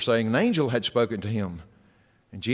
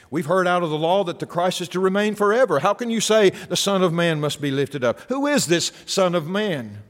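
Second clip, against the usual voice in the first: higher, louder voice of a doubting crowd; challenging crowd; 8 semitones higher, 6 dB louder than usual. Acted. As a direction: throw the crowd's objection back loudly.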